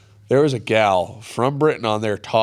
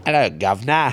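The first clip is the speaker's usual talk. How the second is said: higher, louder mock English greeting; hammy English accent; 5 semitones higher, 5 dB louder than usual. Shown as a demonstration. Showing friends a poor attempt, hammy English accent. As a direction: overdo a bad English accent. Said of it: "try to do English".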